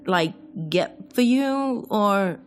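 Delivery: high, slow, tentative offer; hesitant roommate. Acted. Roommate at the bathroom door offers help, hesitant. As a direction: offer help haltingly, voice lifted.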